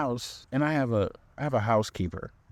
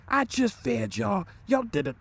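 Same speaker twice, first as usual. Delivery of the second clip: put-on much higher angry tirade at the kids; going off; 13 semitones higher than usual. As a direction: lose it at the kids. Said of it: "she going off on these kids".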